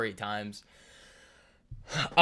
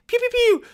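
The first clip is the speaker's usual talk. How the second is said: high-pitched "pew pew" gunshots; mock gunfire; 3 dB louder than usual. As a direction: imitate little shots with your voice. Imitates gunshots in the story.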